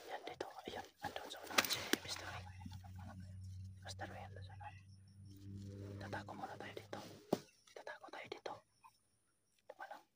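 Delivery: hushed whisper; whispering while hiding. Real, not acted. Hiding and setting up an ambush.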